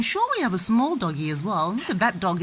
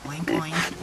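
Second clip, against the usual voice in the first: pig noise; 5 semitones lower, 3 dB quieter than usual. Shown as a demonstration. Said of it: "what sound does a piggy make".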